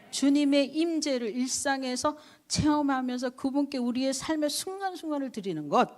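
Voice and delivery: high, loud sermon climax; fervent preaching. Acted. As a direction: press it home to the congregation.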